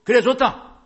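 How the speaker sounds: loud, high burst as God; acted exclamation. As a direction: burst out with a sudden mock-stern verdict. God's angry answer, acted out. (크고 높게 내지르는 하나님 흉내)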